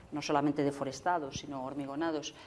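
usual speaking voice

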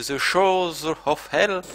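slow, loud, drawn-out English in a French accent; self-mocked English accent. Real, not acted. Reads a game title aloud, self-mocked French-accented English. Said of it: "Mon magnifique accent".

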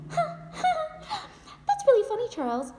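high, strained laugh through the words; forced laughing. Acted. Wife to Charles about his joke, forced laughing. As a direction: laugh it off, close to tears. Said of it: "I'm laughing, I'm not crying".

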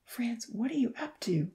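soft, worried whisper. A narrator's reading. Professor watching his son leave, worried whisper. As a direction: whisper it to himself, anxious. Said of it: "the professor whispered".

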